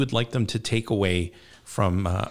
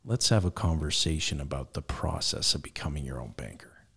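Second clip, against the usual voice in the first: low, soft, smooth radio host; calm velvet late-night DJ; 5 semitones lower, 6 dB quieter than usual. Shown as a demonstration. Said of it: "If I use my late night F M D J voice".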